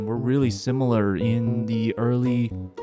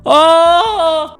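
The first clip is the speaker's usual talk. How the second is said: excited shout; 10 dB louder than usual. Real, not acted. Excited exclamation about traffic.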